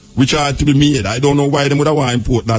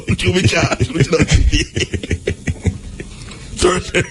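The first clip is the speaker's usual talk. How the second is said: laughing; slower than usual. Real, not acted. Laughing at his own joke.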